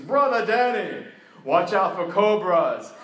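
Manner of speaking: put-on slow, loud warning in an Indian accent. Pastor warning Danny of cobras in a thick Indian accent. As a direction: warn him gravely in a thick Indian accent. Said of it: "Thick, thick accent".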